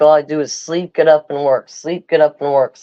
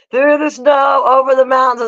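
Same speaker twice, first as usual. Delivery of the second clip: higher mocking parent voice; 6 semitones higher than usual. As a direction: mimic the old lecture, higher. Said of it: "Remember they said that shit?".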